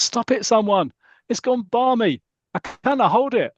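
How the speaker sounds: high-pitched comic panic; panicked plea. Acted. Father Christmas losing control of his horse, panicked. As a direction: call for help in a mock-frantic high voice.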